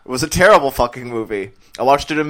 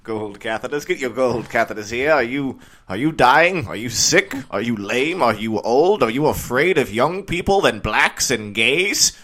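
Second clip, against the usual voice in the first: Australian infomercial pitch. Acted. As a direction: sell gold catheters like an Australian TV pitchman. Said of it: "Murdoch is Australian, so I went somewhere in that direction".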